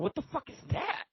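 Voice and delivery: high, alarmed disbelief; a newbie blurting out shock, pitched high; shocked question. Retold or imitated. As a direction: squeak it out, startled and lost. Shocked prison newcomer asks.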